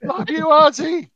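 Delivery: high, loud, drawn-out fan yell; imitated yell. Fan calling out to [name], high imitated yell. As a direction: holler it slowly up to the stage. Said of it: "Montag yells out".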